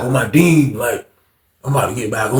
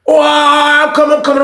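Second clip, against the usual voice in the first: calling back; 11 semitones higher, 3 dB louder than usual. Retold or imitated. Calling back to visitor at door.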